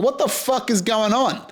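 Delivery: high-pitched, baffled outburst; exasperated disbelief. Retold or imitated. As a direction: blurt it out in rising exasperation, pitched well up.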